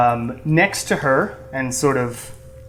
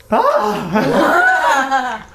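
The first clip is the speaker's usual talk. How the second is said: high, loud gasping "ah"; excited cry; 12 semitones higher, 6 dB louder than usual. Acted. Prisoner spots his friends, high loud delighted cry. Nathaniel suddenly sees old friends.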